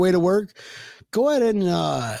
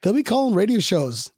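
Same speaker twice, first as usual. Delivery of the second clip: ordinary talk, nothing marked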